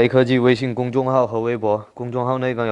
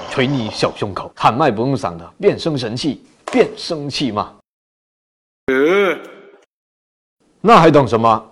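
ordinary talk

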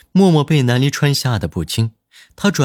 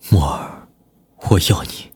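deep, intimate lover's murmur; low, husky-sweet declaration of desire; low, magnetic voice; 4 semitones lower than usual. A narrator's reading. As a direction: drop the pitch, close and seductive. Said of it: "声音低沉磁性".

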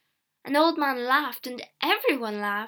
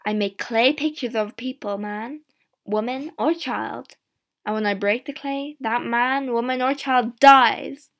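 loud, forceful curse, hitting the key words; calling out a threat; 8 dB louder than usual. A narrator's reading. Grandmother threatens the rich men with curses, calling out. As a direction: threaten them loudly, building to DIES. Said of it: "my grandmother called".

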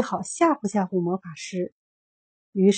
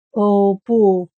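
meek voice; 8 dB louder than usual. A narrator's reading. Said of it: "小个子男人低声下气地说".

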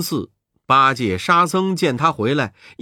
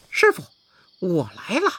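joyful call; faster than usual. Acted. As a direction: greet the captive master gladly but secretly. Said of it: "叫声".

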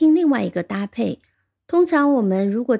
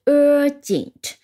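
"urgent" slow, UR held high, then jent; first-syllable stress; 5 semitones higher than usual. Shown as a demonstration. Teacher building the word, "urgent" stressed on long UR. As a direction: draw out the UR in "urgent", drop on jent. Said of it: "重音是在第一音节的".

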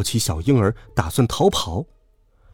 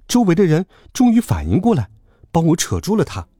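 plain reading voice

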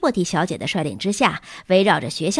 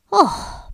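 high, falling moan; groan; 10 semitones higher than usual. A narrator's reading. Pupil hearing that class starts soon, groaning. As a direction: moan in dismay. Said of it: "布鲁诺呻吟道".

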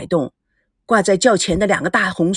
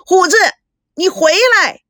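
high, loud call down the alley; loud shout; 9 semitones higher, 5 dB louder than usual. A narrator's reading. Groom shouting after the fleeing friend, loud call. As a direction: shout after him with all your breath. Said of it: "赵建国大声地喊着".

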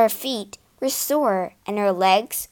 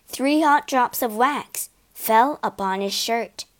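ordinary delivery

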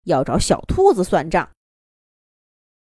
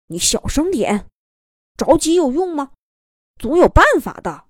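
little mouse voice; 7 semitones higher than usual. A narrator's reading. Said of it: "这是小老鼠的声音".

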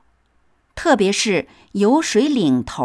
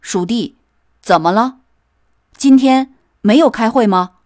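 puzzled; 5 semitones higher, 5 dB louder than usual. Acted. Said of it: "奇怪地问".

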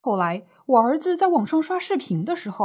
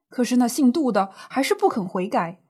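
normal talking voice

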